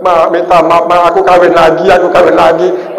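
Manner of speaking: loud mock tearful confession; pengakuan sambil menangis, ditirukan. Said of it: "Ku bilangnya pas nangis itu". (meniru tangisan keras saat mengaku)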